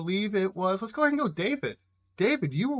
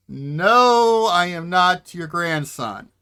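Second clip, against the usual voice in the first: firm correction; 2 semitones higher, 8 dB louder, much slower than usual. Acted. Ned corrects the confused old man.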